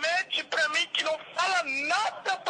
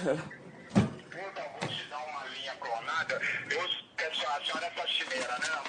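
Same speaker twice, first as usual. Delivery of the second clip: much lower, gruff-pitched man's threat; menacing kidnapper; 12 semitones lower, 7 dB quieter than usual. Acted. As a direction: play the kidnapper taking the phone. Kidnapper voice, softer sneer.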